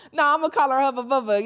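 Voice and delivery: loud, laughing through the words. Real, not acted. Insisting on the nickname to listeners, laughing. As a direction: giggle it out loud. Laughing while naming the girl.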